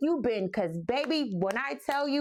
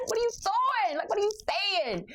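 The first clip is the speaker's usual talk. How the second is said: much higher, shocked what are you doing; shocked disbelief; 12 semitones higher, faster than usual. Retold or imitated. Fan reacting to [name] retiring, higher shocked disbelief. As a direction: squeal it in outraged disbelief. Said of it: "I'm like".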